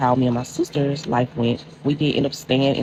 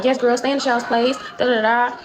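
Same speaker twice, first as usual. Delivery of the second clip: higher, dismissive mama voice; mom brushing her off; 9 semitones higher than usual. Retold or imitated. Mother on the phone telling her daughter to butt out, higher dismissive voice. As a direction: shut the kid down, lightly voiced mom.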